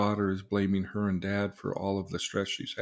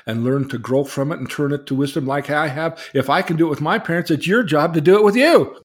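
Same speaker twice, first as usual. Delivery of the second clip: louder and higher, rising to a fast scolding outburst; scolding mother; 5 semitones higher, 10 dB louder than usual. Retold or imitated. Mother scolding her grown daughter, climbing to an angry near shout. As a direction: push harder with each word, up to a near shout.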